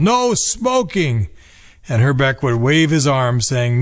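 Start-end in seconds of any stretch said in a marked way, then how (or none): 0.0-1.3 mock announcer's yell, high and drawn out; Bob Casey. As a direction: stretch it out high, imitating a PA warning. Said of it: "while Mister Casey yelled".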